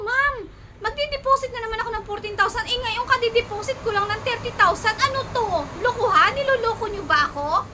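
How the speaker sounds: high, loud, indignant retort; furious at being asked to pay again; angry outburst. Retold or imitated. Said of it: "dun na ako nagalit".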